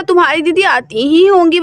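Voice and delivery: normal talking voice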